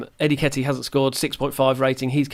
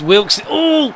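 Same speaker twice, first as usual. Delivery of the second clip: much higher, louder burst of alarm; excited shout; 13 semitones higher, 8 dB louder than usual. Real, not acted. Manager yelling as the striker shoots. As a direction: yelp as the shot goes in on goal. Shot on goal in live commentary.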